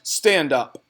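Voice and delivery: loud barked command; barked order. A narrator's reading. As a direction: snap the order out. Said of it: "she barked".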